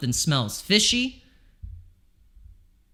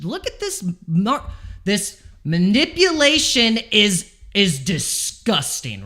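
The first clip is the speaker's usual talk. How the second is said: higher, louder and slower in disbelief; outraged; 7 semitones higher, 4 dB louder than usual. Real, not acted. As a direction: let the outrage burst out. Outraged at market manipulation.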